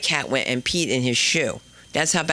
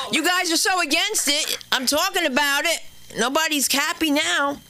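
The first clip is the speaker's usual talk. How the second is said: normal talking voice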